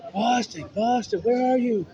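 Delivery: retold scream of a name. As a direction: shout the name high and drawn out like she's searching. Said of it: "she screamed my name".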